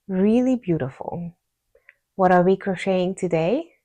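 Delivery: ordinary delivery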